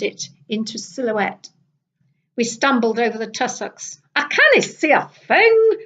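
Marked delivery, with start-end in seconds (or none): 4.1-5.8 high exasperated exclamation; squatting in the dark, high and exasperated; exclaiming. A narrator's reading. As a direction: blurt it out, much higher. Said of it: "exclaimed Kate".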